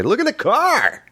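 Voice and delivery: high, bright announcer put-on, far above his own pitch; wannabe broadcaster voice. Mocking wannabe broadcasters, pointing at a passing car, high announcer voice. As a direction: hype a passing car like a radio host. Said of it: "I go".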